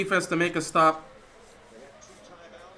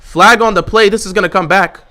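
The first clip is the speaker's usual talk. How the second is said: much louder burst of sudden hope; excited shout; 11 dB louder than usual. Real, not acted. Streamer spotting a flag on the play, excited shout. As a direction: yell it as the flag flies.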